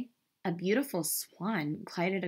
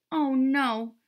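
higher, louder little wail; mildly tearful; 7 semitones higher, 6 dB louder than usual. A narrator's reading. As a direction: lament as a storybook duckling, lightly played. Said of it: "sniffed the ugly duckling".

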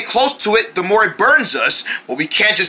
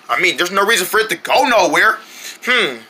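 ordinary talk

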